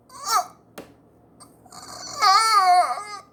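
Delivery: very high strangled groan of pain. Retold or imitated. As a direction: squeak it out as if winded. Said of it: "That motherfucker was like".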